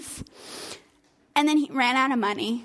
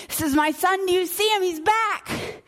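father thrilled his son is home; excited father; 7 semitones higher than usual. Acted. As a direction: call it out to the servants with joy. Father announcing son to servants.

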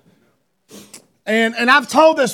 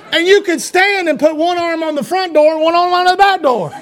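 normal talking voice

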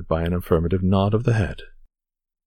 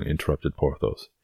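plain reading voice

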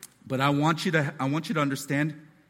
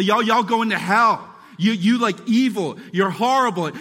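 judgmental picketer; 4 semitones higher, 7 dB louder than usual. Retold or imitated. Picketing church folks yelling at outsiders. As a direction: point fingers and damn them. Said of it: "telling people".

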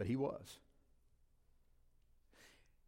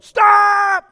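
sharp full-force yell, far higher and louder; coach yells; 23 dB louder than usual. Retold or imitated. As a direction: bark the order across the field. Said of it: "And then he yelled".

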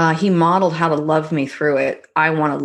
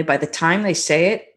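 ordinary delivery

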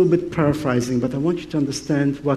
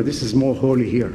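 ordinary talk

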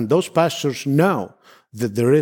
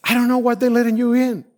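much higher mock voice of the puzzled officer; imitating the lady; 11 semitones higher than usual. Officer puzzled that he gets through, high mock voice. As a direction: play her baffled, pitched way up. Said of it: "She's like".

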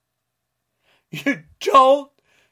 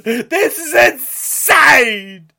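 ordinary delivery

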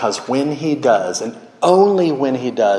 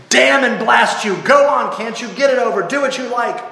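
high, exasperated shout; bellow; 12 semitones higher, 4 dB louder than usual. A narrator's reading. Ghost gives in to the angel, bellowing high. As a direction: bellow it out in furious surrender. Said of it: "bellowed the ghost".

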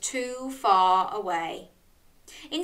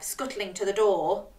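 usual speaking voice

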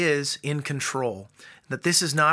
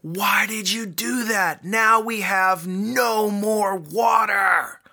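high, slow, loud mock wail of an upset little boy; upset child; 8 semitones higher, 5 dB louder, slower than usual. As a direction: play the toddler crying out that his water is gone. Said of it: "Liam was so upset".

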